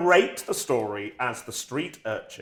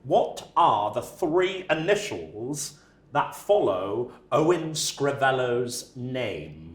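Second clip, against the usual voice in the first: slow quiz read; 4 semitones lower, much slower than usual. Acted. Quiz host reading question.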